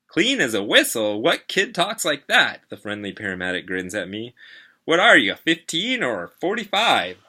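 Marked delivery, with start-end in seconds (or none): 0.1-2.6 loud, high, amused disbelief; mock the phrase with swooping pitch; incredulous teasing. A narrator's reading. Paramedic ribbing a kid's old-fashioned phrase, incredulous. 4.8-7.2 loud, high, swooping question; incredulous teasing. A narrator's reading. Paramedic teasing a kid about his age, incredulous.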